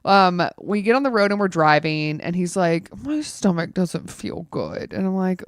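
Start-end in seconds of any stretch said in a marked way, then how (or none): 3.0-4.9 slow mock moan of a sick partner; drawn-out, lightly whiny mimicry; groggy complaint. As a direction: imitate a groggy husband complaining. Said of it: "he's just being kinda, like, groggy".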